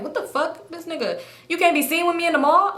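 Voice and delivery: high-pitched indignant complaint; really irritated. Retold or imitated. Woman who can't be seen with him, irritated. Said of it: "I'm really getting irritated".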